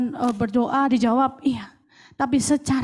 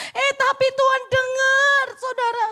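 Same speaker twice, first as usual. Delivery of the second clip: loud high joyful testimony; loud high praise in testimony; joyful proclaiming; 8 semitones higher, 4 dB louder than usual. Real, not acted. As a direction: proclaim it with thanks. Triumphant testimony climax.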